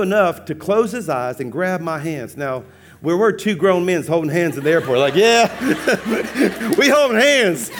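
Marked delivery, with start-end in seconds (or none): 5.1-7.7 high, loud, laughing boast; mock-defiant shout. Pastor owning up to holding hands, mock defiance. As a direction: holler it proudly for comic effect.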